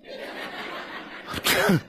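soft unvoiced chuckle; breathy laugh. Real, not acted. Preacher laughing at relationship drama, soft chuckle. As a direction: laugh quietly to yourself.

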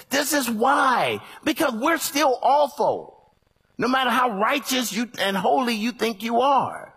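loud, high preaching shout; full-force preaching. Acted. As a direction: preach it hard to the church.

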